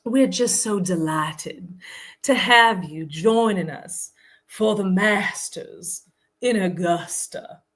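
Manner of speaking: slow, drawn-out Southern drawl; syrupy-slow Southern accent, every word stretched; very Southern older lady. Retold or imitated. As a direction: imitate an older lady's unhurried Southern speech. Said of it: "she talked very slow".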